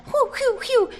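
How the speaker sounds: high, airy whoosh noises; cartoon flying sound; whooshing paper. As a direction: imitate paper fluttering out with little high whews. Imitates paper flying from the cup.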